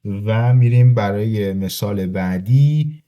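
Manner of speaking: normal talking voice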